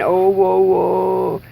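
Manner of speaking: "O, O, O" drawn out with rounded lips; exaggerated vowel exercise. Shown as a demonstration. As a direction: round and stretch each "O" as a drill. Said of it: "do those mouth exercises".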